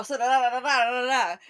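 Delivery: ordinary talk, nothing marked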